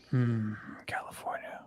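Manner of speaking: soft unvoiced whisper. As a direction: breathe it out as a whisper. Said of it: "in my whisper voice".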